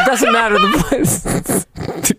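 usual speaking voice

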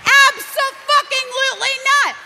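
high and loud, every syllable punched; loud emphatic refusal. Retold or imitated. Turning down the New York trash rant, loud and high. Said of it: "And I was like".

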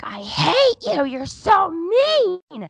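put-on high, loud, drawn-out child's howl; imitated bawling. Son to his mother once inside, mock bawling. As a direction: howl it like a furious little boy. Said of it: "he's just bawling".

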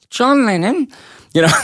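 high squeaky imitation of [name]; bad [name] impression. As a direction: squeak out the name. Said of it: "and then he was doing".

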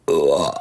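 low, loud belching grunt; loud burp grunt. Acted. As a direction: belch it out deep. King's enormous burp.

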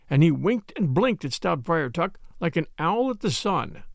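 plain reading voice

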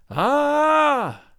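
long, high-pitched held "aaah"; high "ah" cry. A narrator's reading. Eerie voice answering the lying man, long high "aaah". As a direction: wail a sustained high "aaah". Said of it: "said a voice".

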